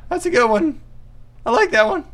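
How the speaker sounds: very high, servile squeak; obey in a tiny submissive voice; meek underling. Acted. Diner answering bully Chad by phone, squeaky and submissive.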